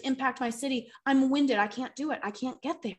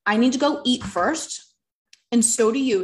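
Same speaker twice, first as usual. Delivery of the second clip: husband's firm call; 8 dB louder than usual. Retold or imitated. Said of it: "my husband said to me".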